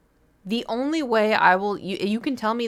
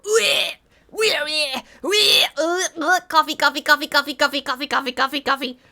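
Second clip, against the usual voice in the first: high loud wailing sobs over the word coffee; crying wail; 7 semitones higher, 5 dB louder than usual. Retold or imitated. Retelling the noise made after sipping coffee, wailing. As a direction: wail like a child bawling. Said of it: "Do you wanna hear the noise that I made".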